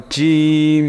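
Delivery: জিম with a hard j and long vowel; firm jim. Shown as a demonstration. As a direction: hit the j of জিম firmly, then stretch it. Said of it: "শক্তপোক্ত উচ্চারণ হয়". (শক্ত জ দিয়ে টেনে জিম)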